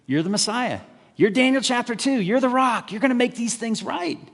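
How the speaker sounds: high, excited acclaim; hailing crowd. Retold or imitated. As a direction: cheer him in like a jubilant crowd. Crowd hailing Jesus as king.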